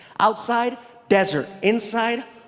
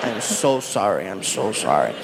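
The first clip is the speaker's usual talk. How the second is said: low apology; 12 semitones lower than usual. Retold or imitated. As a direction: drop deep and draw the apology out. Apology in retold scene.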